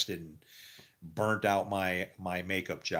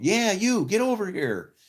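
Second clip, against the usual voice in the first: much higher, loud imitation of the makeup lady; calling over; 12 semitones higher, 7 dB louder than usual. Makeup lady waving him over, high-pitched and loud.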